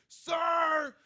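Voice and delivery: very high slow yelling vendor; mock vendor yelling about a sausage; screaming. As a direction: shout it across the flea market. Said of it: "screaming it".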